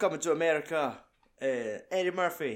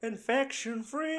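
movie line quote; 11 semitones higher, slower than usual. Retold or imitated. Quoting the film's line.

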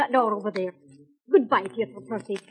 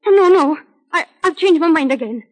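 panicked refusal; 3 semitones higher, 7 dB louder than usual. Acted. Peg panics at the jail plan.

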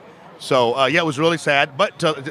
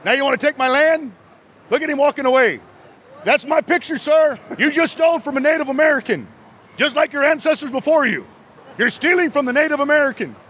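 mock meltdown yelling; 8 semitones higher than usual. Vendor yelling after a man who took his picture, mock outrage. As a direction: holler after him in a hammed-up fury. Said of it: "he was screaming at that guy walking away".

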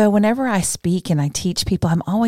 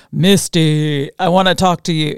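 mock voice of God; 5 dB louder than usual. Mock booming God from heaven.

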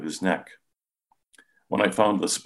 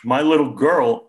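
higher, louder tearful lament; a father sobbing over his daughter, higher and louder; choked sobbing; 8 semitones higher, 8 dB louder than usual. A narrator's reading. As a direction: cry out for his lost daughter. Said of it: "he choked out".

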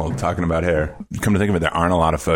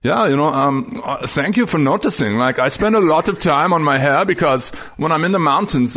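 higher, lightly accented Reinhold Messner impression; gentle Austrian-accented thank-you; imitating Messner's accent; 8 semitones higher than usual. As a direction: do a humble Tyrolean mountaineer, a bit higher. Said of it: "I don't wanna do his accent".

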